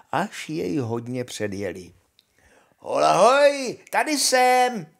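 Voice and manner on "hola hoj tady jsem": high, loud, stretched halloo; calling after someone. A narrator's reading. Honza catches up with his brothers, calling loudly. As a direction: holler to those far ahead. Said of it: "volal za nimi zase hloupý Honza".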